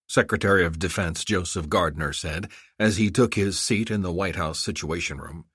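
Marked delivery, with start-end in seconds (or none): none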